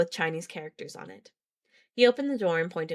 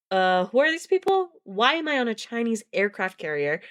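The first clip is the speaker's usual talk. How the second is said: high-pitched, incredulous questions; exasperated questioning; 5 semitones higher than usual. Acted. Grace confronting strangers at a meeting, exasperated questioning. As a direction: demand answers in bewildered exasperation. Confused character demands answers at meeting.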